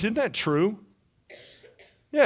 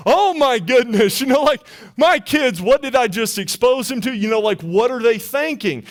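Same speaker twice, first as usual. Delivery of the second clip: higher, louder panicked rush of worry; a dad in a mild mock panic over his kids; freaking out; 4 semitones higher, 4 dB louder than usual. Dad panicking over naked bikers near his kids. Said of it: "he's freaking out".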